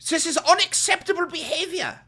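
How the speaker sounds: screaming zealot, high and loud; hammed-up outraged loyalist yelling; shrieking mock fascist; mock fanatic shout. Mocking a MAGA fanatic.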